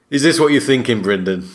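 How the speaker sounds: ordinary delivery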